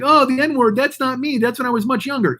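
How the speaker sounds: high mock whimper of an excuse; a hint of fake crying; mock whining apology. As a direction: whine it like a caught YouTuber. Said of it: "they whine and they cry".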